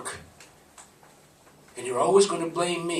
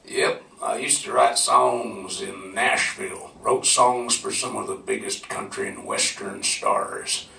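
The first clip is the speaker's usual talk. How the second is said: put-on lower, slow Southern accent, an old Nashville songwriter; relaxed Southern accent, lower and unhurried; Southern drawl; 6 semitones lower, slower than usual. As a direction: lean back into a lazy Southern accent. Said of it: "he was talking in a southern accent".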